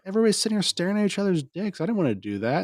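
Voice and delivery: fast, high squeaky voice, dropping back down at the end; mock defensive self. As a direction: rattle it off high in your own defence. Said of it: "I was like".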